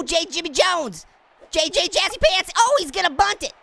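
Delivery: ordinary talk, nothing marked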